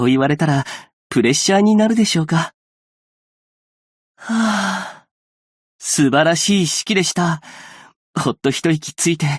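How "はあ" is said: high, airy sigh. Acted. After a wonderful ceremony, with a light sigh. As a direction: let out a long light sigh.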